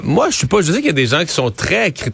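ordinary talk, nothing marked

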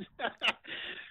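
a breathy, choked little laugh; laughing through tears he tried to hold back; teary laugh. Real, not acted. Caller breaks down on air, teary laugh. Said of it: "I promised I wasn't gonna cry".

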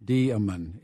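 demon slowly split as DEE-uh-mun; three-syllable demon. Shown as a demonstration. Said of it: "is how that's pronounced".

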